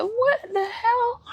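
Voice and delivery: sit bolt upright and squeal it in disbelief; mock outrage. Sat up in bed watching.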